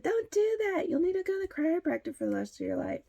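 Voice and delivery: high, loud mock warning; mock scolding adult. Voicing adults who scolded her.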